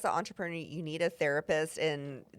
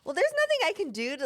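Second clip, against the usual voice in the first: pitched up, fed-up reply; exasperated retort; 10 semitones higher than usual. Retold or imitated. Shop owner retorting to a rude customer, exasperated. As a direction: throw up your hands at the customer. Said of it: "and I was like".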